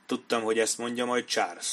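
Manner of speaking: ordinary delivery, nothing marked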